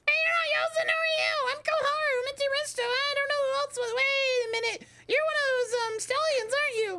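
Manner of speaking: extremely high squeaky voice; loud, tiny squealing chatterbox; Koharu, a chatty child. Acted. Excited child Koharu meeting a stranger, very high squeaky voice.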